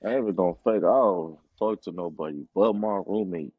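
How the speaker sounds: ordinary delivery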